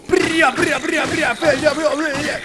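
high, trilled gibberish yelling; frantic battle cry. Pretend. Mop knight attacking bokoblins, high frantic gibberish. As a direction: shriek nonsense as you charge.

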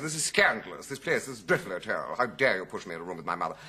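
mild mock outrage; a touch of affronted hotel guest; making a scene. Guest to hotel staff, mock indignant. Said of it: "I made a great scene".

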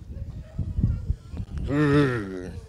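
drawn-out staccato chuckle; Count's theatrical ha-ha-ha; vampire laugh. Acted. Vampire host amused by trick-or-treaters, staccato laugh.

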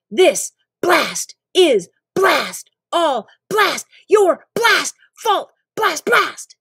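high, loud words punched out slowly; furious rant. Acted. Narrator's angry speech at Eric between blasts. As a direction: spit each word out in fury. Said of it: "By the end of my little speech".

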